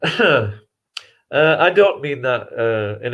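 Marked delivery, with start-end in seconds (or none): 0.0-0.5 lightly put-on Yorkshire voice, pitched up at the start; Yorkshire dialect. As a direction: imitate a local in a Yorkshire pub. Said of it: "They talk like this around here".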